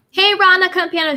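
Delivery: high, bright hello; excited greeting. Real, not acted. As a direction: call out to a viewer.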